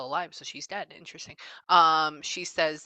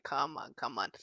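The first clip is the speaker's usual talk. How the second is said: quiet coaxing; 5 semitones lower, 8 dB quieter than usual. Real, not acted.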